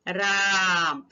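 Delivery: राम hailed from afar, the आ long and held; calling a name. Shown as a demonstration. Teacher showing how we call someone, long calling voice. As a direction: call राम with the vowel drawn out over three beats. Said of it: "अगर हम किसी को पुकारते हैं".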